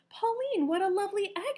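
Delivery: high, bright-toned delighted lady; Missus Pennywort's voice. A narrator's reading. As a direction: lift the voice for the cheerful farm lady. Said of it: "she said".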